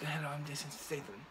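tossed-off Jason Statham accent impression, said half under the breath; a quick, soft nod to the actor's accent. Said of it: "he has his accent in there".

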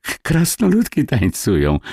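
high-pitched, stammering alarm; frightened child calling to daddy; sudden terror. A narrator's reading. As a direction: blurt it out in fright. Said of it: "woła Wojtuś w nagłym przerażeniu".

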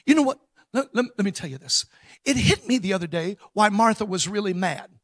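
ordinary delivery

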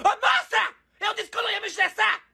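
high-pitched comic outburst; exasperated yelling. Real, not acted. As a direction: lose patience and shriek it. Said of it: "eu tô gritando".

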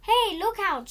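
high, loud warning shout. Acted. Willy warning the baby in the shop, shouting. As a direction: yell to save the baby. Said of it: "shouted willy-nilly".

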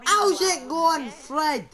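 loud, drawn-out greeting called across; calling out. Pretend. Player hailing Fred in the game, calling out.

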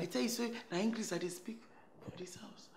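whispered confession. Acted. Confiding secret.

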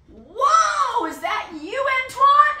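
loud, high astonished holler; high-pitched surprised shout; amazed calling out. Retold or imitated. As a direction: yell back in happy disbelief. Calling back across the field.

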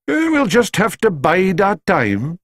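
high, gentle Scottish doctor voice. A narrator's reading. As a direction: play the patient old doctor.

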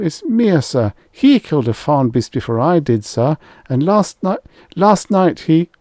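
high, loud, frantic begging; desperate plea. Acted. Rye desperately shifts blame to Mia, high and frantic. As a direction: beg for your life. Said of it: "lips bloodless, hands trembling".

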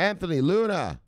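high-pitched call of a viewer's name; playful high shout-out to a follower. Pretend.

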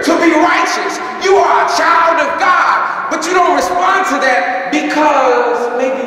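high, loud preaching shout; preaching at full force. Real, not acted. Pastor rousing the congregation, full-force preaching. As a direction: belt it to the back pews.

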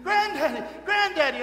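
high, loud, repeated calls; urgent boyish yelling, easing slightly; screaming for granddad. Acted. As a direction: cry out like an over-excited little boy. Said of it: "he started screaming".

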